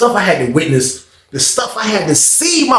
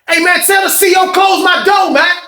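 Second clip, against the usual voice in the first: high shout across the block; calling out; 13 semitones higher than usual. Retold or imitated. Prime calling to the guard to close his door, shout. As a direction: call out to the guard.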